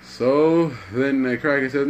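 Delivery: plain reading voice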